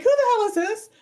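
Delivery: high, loud mock outrage; indignant girlfriend. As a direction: play the girlfriend bursting in, offended. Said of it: "she's like".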